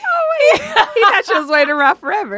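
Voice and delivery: loud, laughing through the line; laughing hard. Real, not acted. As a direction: crack up while saying it. Friend laughs at the story.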